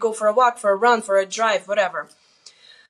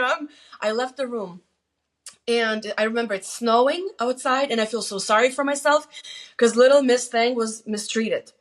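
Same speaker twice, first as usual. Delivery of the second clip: ordinary delivery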